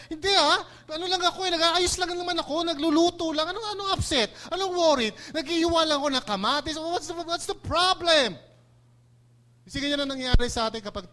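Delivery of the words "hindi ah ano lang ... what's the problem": high, loud defensive protest; defensive denial. Retold or imitated. Martha defending herself to Jesus, high and indignant. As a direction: brush it off, insisting she's fine.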